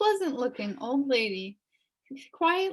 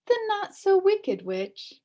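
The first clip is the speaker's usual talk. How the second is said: put-on cheerful old witch; 6 semitones higher, 4 dB louder than usual. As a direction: reassure the frightened boy. Witch introduces herself.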